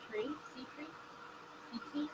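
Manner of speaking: hushed, puzzled whisper. Real, not acted. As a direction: whisper the name under your breath. Sounding out a cat's name.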